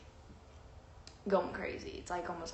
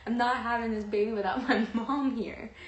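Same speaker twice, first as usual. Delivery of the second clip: louder, insistent mock protest; insistent refusal; 3 semitones higher, 6 dB louder than usual. Labouring daughter refusing to push, loudly insistent. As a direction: retell a stubborn refusal with a laugh in it.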